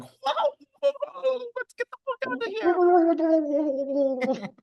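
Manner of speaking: very high, slow, squeaky voice; squealing Antonia. Retold or imitated. Antonia escaping her cell, very high squeaky voice.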